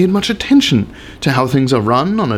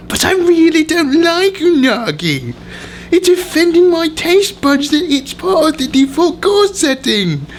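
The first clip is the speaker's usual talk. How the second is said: higher, laughing whine of a picky customer; whiny diner; 12 semitones higher than usual. Acted. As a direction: complain like a spoiled patron who is sure he's right. Acted complaint of a belligerent diner.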